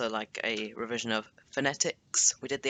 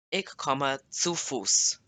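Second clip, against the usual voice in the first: slow model sentence; 3 semitones higher, much slower than usual. Shown as a demonstration. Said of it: "teacher models answer sentence".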